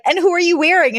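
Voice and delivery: high, bright-eyed mock reporter question; imitated eager journalist. Journalist interviewing a supposed supermodel, imitated high eagerness. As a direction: ask it high, like red-carpet press. Voicing red carpet journalists.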